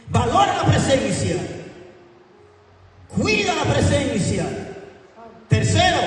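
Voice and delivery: high-pitched, strained shout to the congregation; emphatic preacher's shout; high preaching cry. Real, not acted. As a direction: cry out each exhortation way up high. Preacher exhorts congregation, listing his points.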